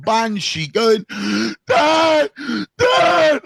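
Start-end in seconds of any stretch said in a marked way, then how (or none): 1.7-3.4 mock yell of a scared boy; imitating son's scream. Retelling his son calling from bed, mock yell. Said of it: "he starts screaming his head off".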